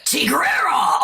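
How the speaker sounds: drawn-out, rough character cry; Tigrera. Shown as a demonstration. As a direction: stretch the name into a long cartoon roar. Demonstrates her cartoon character's voice.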